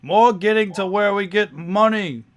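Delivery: loud, high-pitched impatient adventurer; impatient Guntroll. Acted. Guntroll cuts short the party's chatter, loud and impatient. Guntroll answers in character, loudly.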